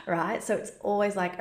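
ordinary talk, nothing marked

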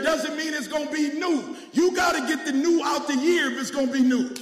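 loud, high shouted preaching; preaching at full force. Acted. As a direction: whip the church up, full voice.

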